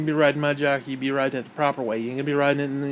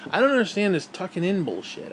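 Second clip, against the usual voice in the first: ordinary talk, nothing marked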